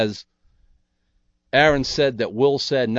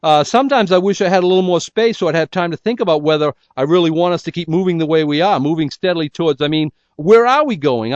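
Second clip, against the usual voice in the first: higher, louder, fretting and rushing through doubts; Martha's anxious thoughts; 7 semitones higher, 6 dB louder than usual. Acted. Martha wondering about the relationship in the car, anxious and pitched up. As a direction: voice Martha spiralling in worry, pitched up. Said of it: "And Martha is thinking".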